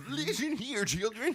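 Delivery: put-on high, jovial old-timer; old guy voice. Old man calling children to listen, high jovial voice. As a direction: address them as a high-pitched comic grandpa. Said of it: "your old guy versus my old guy".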